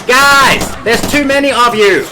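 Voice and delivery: high, loud cry of alarm; frantic shout. Real, not acted. Swarmed by enemies in game, yelling high and loud. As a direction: yell out over the swarm.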